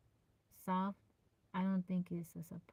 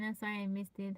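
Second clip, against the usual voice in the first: ordinary talk, nothing marked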